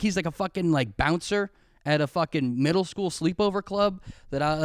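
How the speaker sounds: normal talking voice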